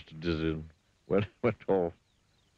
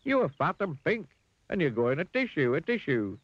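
high, triumphant figure voice; Mister Dizzy; 11 semitones higher, 3 dB louder than usual. A narrator's reading. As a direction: rub it in, pitched right up. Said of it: "said Mister Dizzy".

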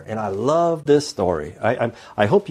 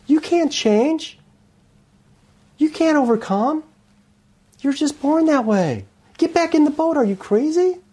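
much higher, mocking and incredulous; scoffing crowd; 11 semitones higher than usual. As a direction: ridicule them back into the boat. Boat crowd scolds new Christians.

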